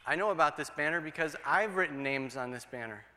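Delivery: ordinary talk, nothing marked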